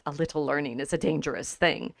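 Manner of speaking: low, soft inner thought; thinking to himself. Acted. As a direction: murmur it as a private reflection. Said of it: "he's thinking to himself".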